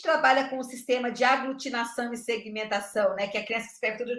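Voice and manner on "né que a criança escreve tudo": normal talking voice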